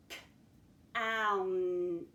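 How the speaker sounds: "K, ount" thrown out as separate sounds; sounding out. Shown as a demonstration. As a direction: punch out each sound of "K, ount". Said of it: "We're gonna throw out those sounds".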